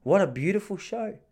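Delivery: high, breathy-free gushing praise; mock delight over the show; lightly mocking enthusiasm; gushing fan. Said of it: "And they go".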